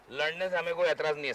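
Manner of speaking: ordinary delivery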